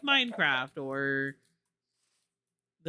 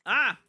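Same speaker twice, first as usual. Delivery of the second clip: startled yelp; 7 semitones higher, 5 dB louder than usual. Real, not acted. Startled by skeleton in game.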